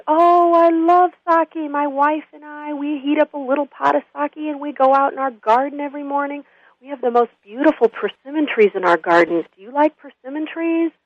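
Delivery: put-on high, gentle old man's voice, unhurried start; chummy old man. Old man befriends the drunk laborer, chummy high voice. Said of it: "like they're best of buds".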